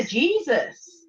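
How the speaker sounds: ordinary delivery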